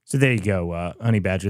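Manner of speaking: normal talking voice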